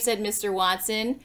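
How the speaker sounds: ordinary delivery, nothing marked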